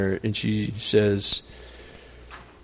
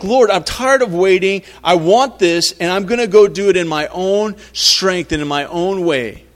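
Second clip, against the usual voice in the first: impatient complaint; 6 semitones higher, 6 dB louder than usual. Retold or imitated. Voicing an impatient believer's prayer.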